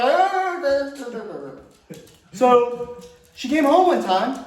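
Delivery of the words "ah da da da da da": happy soap humming. Pretend. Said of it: "I'm like".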